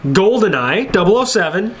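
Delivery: ordinary delivery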